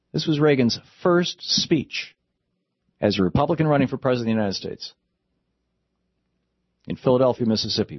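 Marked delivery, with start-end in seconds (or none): none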